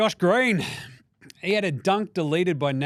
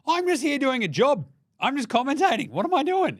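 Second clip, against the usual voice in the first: high, whiny put-upon voice, pitched up; mock Draymond; 11 semitones higher, 4 dB louder than usual. Draymond mocking himself to heckling fans, high whiny voice. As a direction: play it innocent, just doing a job.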